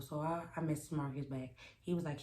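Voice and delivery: ordinary talk, nothing marked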